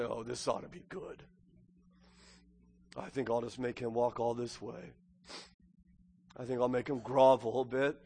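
low, very soft self-satisfied musing; smug scornful father. Acted. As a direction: quietly relish making him suffer. Imagines smug father waiting on porch.

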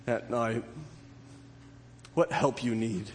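hushed reassuring pastoral voice; tender hush. Acted. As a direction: speak to the sleepless gently.

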